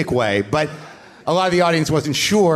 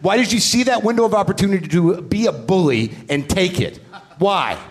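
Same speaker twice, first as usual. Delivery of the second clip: higher mock fury at the heckler; retold angry confrontation; 6 semitones higher than usual. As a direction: berate him like a bully caught out. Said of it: "I said".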